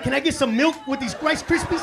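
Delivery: loud, high mocking jab; sarcastic shout. Husband mocking wife's crunchy rice, loud. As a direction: yell the joke at your wife.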